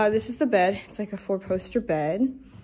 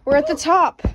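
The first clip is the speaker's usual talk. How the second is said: excited; 8 semitones higher, 5 dB louder than usual. Real, not acted. Reaching the roof at last.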